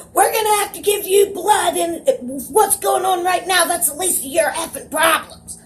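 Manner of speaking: high-pitched rant of an exasperated doctor; imitated screaming. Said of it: "the doctor starts screaming at me".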